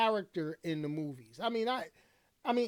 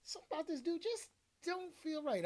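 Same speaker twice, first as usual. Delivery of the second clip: mock inner voice; 11 semitones higher, 5 dB quieter than usual. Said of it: "I was like".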